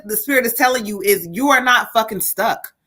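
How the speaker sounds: ordinary delivery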